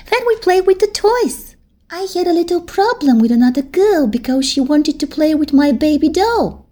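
put-on a little girl envying her big friend; small girl voice. Teddy's voice.

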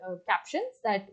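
usual speaking voice

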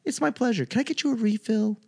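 high, soft customer-service imitation; sweetly helpful, high-pitched server voice; pleasant server. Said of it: "But they're so pleasant".

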